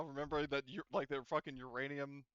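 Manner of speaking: ordinary delivery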